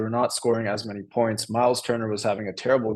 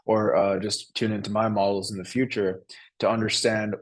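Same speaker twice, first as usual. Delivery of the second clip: usual speaking voice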